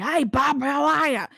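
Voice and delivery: broad Australian hello, mock rough; bogan dad. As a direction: imitate a loud Aussie bogan father. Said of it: "And then Dad's like".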